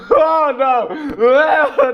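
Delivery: shrieking oh no through laughter; real fright, high screaming; startled scream. Real, not acted.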